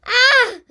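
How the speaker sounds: high, loud scream. A narrator's reading. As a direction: let out a full scream. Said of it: "Jane kept screaming".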